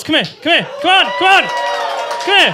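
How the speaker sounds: high, loud calling; coaxing shout. Acted. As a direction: call out to lure them over.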